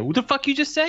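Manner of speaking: high-pitched incredulous challenge; hammed-up, squeaky disbelief; mock outrage. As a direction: play an offended man demanding an answer. Friend acts out boyfriend's challenge.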